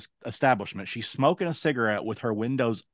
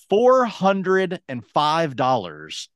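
slow, emphatic Four hundred and five dollars; indignant; 6 semitones higher, 4 dB louder, much slower than usual. Real, not acted. Telling friend the price, slow and indignant. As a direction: lean on every word in disbelief.

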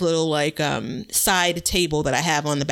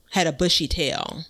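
ordinary talk

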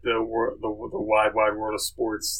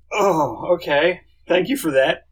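surprised; 6 semitones higher, 4 dB louder than usual. Retold or imitated. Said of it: "and I was like".

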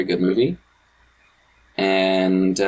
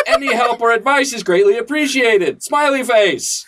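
shouting capitalized words; 9 semitones higher than usual. Shown as a demonstration. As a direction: shout every word like caps lock. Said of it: "I'm shouting this because it's in all caps".

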